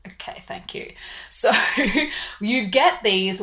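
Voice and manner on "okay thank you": flat, breathless whispered thanks; a whispered, unimpressed reply to a pimple remark; deadpan whisper. Retold or imitated. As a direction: mutter the thanks under your breath, unimpressed. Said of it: "And you're like".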